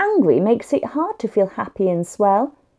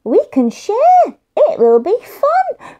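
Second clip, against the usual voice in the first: put-on high, slow little boy voice, louder; high child voice; 11 semitones higher, 5 dB louder, slower than usual. Tom offers to share, slow high child voice. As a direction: stretch it out like a happy small boy. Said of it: "Tom said".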